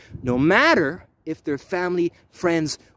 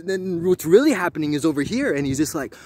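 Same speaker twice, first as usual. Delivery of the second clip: normal talking voice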